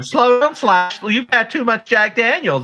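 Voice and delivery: high, scolding girlfriend impression; mock girlfriend voice. Girlfriend telling [name] to slow down, mock scolding. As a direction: chide him like a nagging girlfriend.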